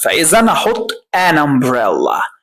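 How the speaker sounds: ordinary talk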